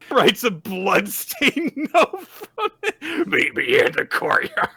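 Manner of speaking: high, loud laughing read. Real, not acted. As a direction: laugh your way through the words. Laughing while retelling manga scene.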